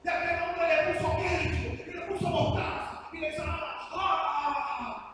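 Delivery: high, loud revivalist cries; mock fiery preaching. Shown as a demonstration. Preacher demonstrating on a sandwich how emotions get stirred, mock fiery preaching. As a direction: preach it with exaggerated fervor, pitched high. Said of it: "lo voy a hacer aquí frente".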